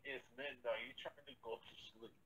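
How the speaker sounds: ordinary talk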